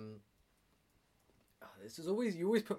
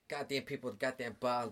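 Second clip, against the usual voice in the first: put-on Boston accent. Bartender complaining about customers at work, in a Boston accent. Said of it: "in a Boston accent".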